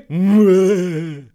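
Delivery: loud high cackle; booming villain laugh; evil laugh. Acted. Genie laughs after line.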